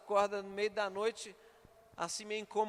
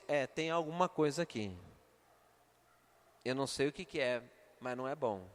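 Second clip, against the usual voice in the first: ominous; 5 semitones lower than usual. Retold or imitated. Husband senses something evil.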